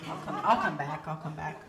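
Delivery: soft, low mumbled excuse; meek waitress trailing into near silence; awkward retreat. Acted. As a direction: back away verbally, fading out. Waitress backing away from customer.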